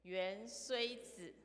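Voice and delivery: 原虽子 very slowly, each syllable held; slow lead-reading. Shown as a demonstration. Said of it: "请跟我一起念一遍". (一字一字慢慢带读“原虽子”)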